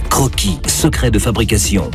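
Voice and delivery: usual speaking voice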